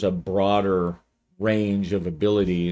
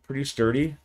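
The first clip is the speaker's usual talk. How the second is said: ordinary delivery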